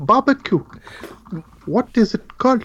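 hammed-up South African, asking around; bad South African accent, joking. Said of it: "my South African accent".

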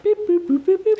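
high, chirpy robot beeps; robot beeping. Retold or imitated. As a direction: beep like a little machine. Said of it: "somebody be like".

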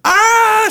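high short scream; mock scream. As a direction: scream "Ah!" in fright.

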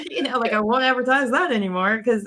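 ordinary delivery

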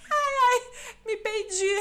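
high, loud yelp breaking into laughter; laughing shriek. Retold or imitated. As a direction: mom yelping between laughs. Mom laughing after farting.

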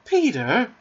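high, excited exclamation; crying out. A narrator's reading. Wendy clutching Peter on learning of a fairy, crying out. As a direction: call his name in amazement. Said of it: "she cried".

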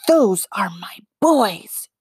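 slow, unvoiced numb whisper. A narrator's reading. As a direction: whisper it as if in shock. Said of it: "repeated numbly".

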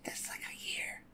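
voiceless whispered aside. Real, not acted. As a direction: breathe it as an aside.

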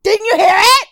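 hammed-up offended insisting; mock indignant. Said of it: "She's like".

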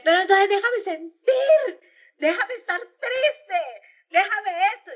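high, slow whimpering plea that cracks into laughter; hammed-up crying, half laughing at yourself; a put-on sob, pitched high and drawn out; mock tearful wail. Mock pleading to be left sad.

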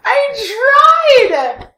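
loud, high protest; half-laughing yell. Real, not acted. Defensive shout while laughing.

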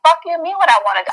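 retold exasperation, rattled off quickly; irritated. Telling a friend about the date's question, irritated. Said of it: "I got so irritated".

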